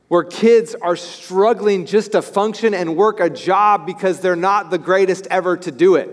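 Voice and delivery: ordinary delivery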